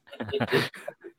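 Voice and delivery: brief breathy chuckle; a quick real laugh; short laugh. Real, not acted. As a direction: chuckle after teasing a friend. Laughing after teasing the co-host.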